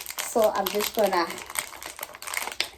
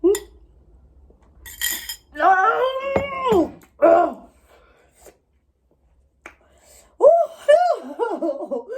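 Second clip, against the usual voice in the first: gasping and groaning from the heat; yelping through a burning mouth; mouth burning from spicy food; 8 semitones higher, 5 dB louder, much slower than usual. Real, not acted. Spicy noodle challenge, burning mouth.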